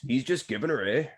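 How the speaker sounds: broad Canadian accent with eh. Shown as a demonstration. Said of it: "That's what we say".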